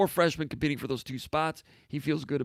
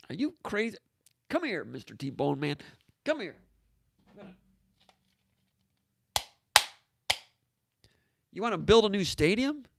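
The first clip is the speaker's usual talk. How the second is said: incredulous; 6 semitones higher than usual. Real, not acted. Incredulous at stadium question.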